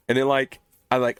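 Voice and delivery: normal talking voice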